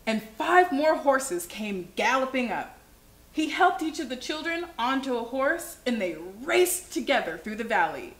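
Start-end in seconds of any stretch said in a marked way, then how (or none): none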